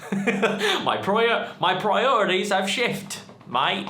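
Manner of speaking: high, loudening mock British accent. Vlogger jokingly repeats himself to viewers in a bad British accent. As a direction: put on a playful, exaggerated British accent. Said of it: "did that in a British accent".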